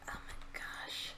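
slow, very soft whisper; awed whisper. Real, not acted. Player overwhelmed by the find, awed whisper. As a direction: whisper it, stunned by your luck.

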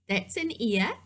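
ordinary delivery

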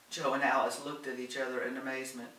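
ordinary delivery, nothing marked

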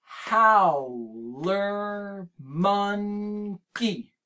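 howler monkey in four slow clapped beats, how-ler mon-key; chunked into syllables. Shown as a demonstration. Teacher chunking the animal name with the kids, slow. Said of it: "Let's try it again".

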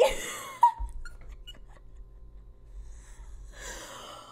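high breathy wheeze of laughter; breathless laugh. Real, not acted. She laughs breathlessly at the bully's taunt. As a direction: laugh it off in disbelief.

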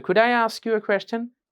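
slow, formal polite ask. Shown as a demonstration. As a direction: stress Could for formality. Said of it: "could বললে এটা একটা formal অ্যাপ্রোচে হয়". (Could জোর দিয়ে formal ভঙ্গিতে)

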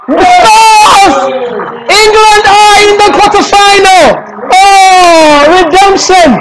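very high, elated victory scream; triumphant commentary. Real, not acted. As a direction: proclaim a historic win. Live call of winning penalty.

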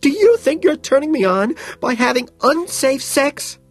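very high, loud scream; screaming back. A narrator's reading. Woman screaming back at man who slapped her, screaming. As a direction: scream the retort back at full pitch. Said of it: "she screams back".

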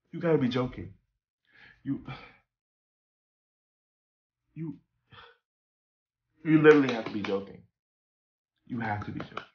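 too tired to fight; weary disbelief. Retold or imitated. To the RA at dawn, softly stunned.

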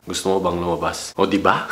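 low, smooth pickup line; deep voice asking a girl out. Shown as a demonstration. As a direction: drop your voice down low and ask. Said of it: "pababain mo yung tono ng boses mo".

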